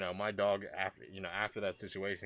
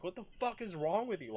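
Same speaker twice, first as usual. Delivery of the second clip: high-pitched retold outburst; incredulous, much higher-pitched reenactment; freaking out; 12 semitones higher than usual. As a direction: relive yelling at a careless dog owner. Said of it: "I'm sitting there freaking out".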